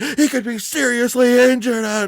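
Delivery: high, slow mock-worried scolding; concerned fans. Upset Baltimore fans scolding the cheering visitors, mock concerned. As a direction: imitate worried locals lecturing. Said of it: "They were like".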